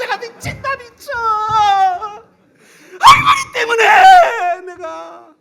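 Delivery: high, drawn-out wailing shout; crying out in frustration, stretched long and loud; frantic wail. Retold or imitated. Boy losing it at grandma, wailing out loud. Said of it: "아이고".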